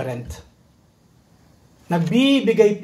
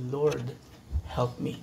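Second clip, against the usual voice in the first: hushed plea; 5 semitones lower, 11 dB quieter, slower than usual. Acted. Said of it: "inulit lang niyang kanyang sinabi".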